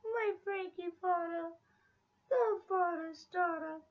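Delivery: put-on high baby talk. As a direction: coo softly at the dog. Cooing at the dog.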